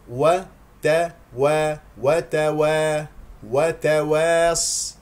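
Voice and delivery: "وتوا" very slow, thin fronted wae; correct light و. Shown as a demonstration. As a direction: build "وتواص" keeping the alif light. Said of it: "مستفلة". (ترتيل "وتوا" بواو مرققة)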